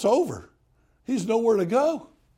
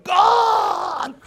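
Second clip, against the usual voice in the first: loud, much higher shout of God; screaming prayer; 13 semitones higher, 7 dB louder than usual. Retold or imitated. Georgia prayer meeting screamer, loud high shout. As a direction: bellow it at the top of your lungs. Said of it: "they'll scream at the top of their lungs".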